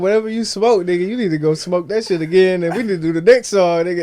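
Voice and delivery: loud, high, insistent ribbing; retold order. Retelling what he told his bandmate, loud and insistent. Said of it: "I said".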